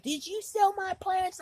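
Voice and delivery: very high-pitched mock accusation; high, held-up imitation of disbelief; imitated accusing cousin. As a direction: mimic an upset cousin's question, voice shooting way up. Cousin's accusing question acted out.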